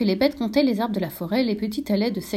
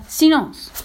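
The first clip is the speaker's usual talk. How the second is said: sharp order; 8 semitones higher, 7 dB louder than usual. A narrator's reading. Dog silencing the quarrel.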